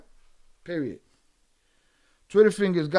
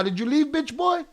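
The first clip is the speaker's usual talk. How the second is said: usual speaking voice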